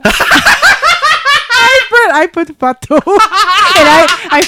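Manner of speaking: high, loud, giggling through the words; gleeful confession through laughter; laughing while talking. Real, not acted. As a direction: barely get it out for laughing. Laughing at her own tease.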